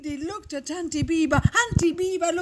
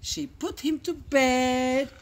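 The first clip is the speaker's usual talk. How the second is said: ordinary delivery, nothing marked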